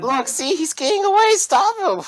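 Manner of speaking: very high, frantic character voice; panicking shop owner. Acted. Store owner sees the robber getting away, high panic. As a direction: squeal it in a high-pitched panic. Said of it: "he's kinda panicking".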